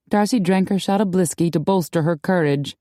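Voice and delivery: plain reading voice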